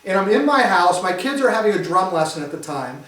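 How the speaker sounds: normal talking voice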